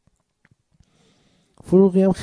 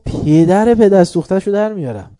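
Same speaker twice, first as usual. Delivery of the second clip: high, loud threatening yell; furious shout; 7 semitones higher, 9 dB louder than usual. Retold or imitated. Cleric shouting at the death-warrant messenger, furious. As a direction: bellow the threat. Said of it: "داد زد".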